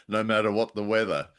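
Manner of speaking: usual speaking voice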